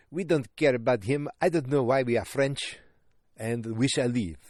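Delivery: put-on botched Russian accent that comes out French; mock a haughty Russian, accent slipping into French; bad Russian accent. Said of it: "I can't do a Russian accent".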